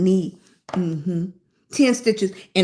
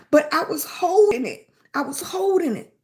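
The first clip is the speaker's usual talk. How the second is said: ordinary delivery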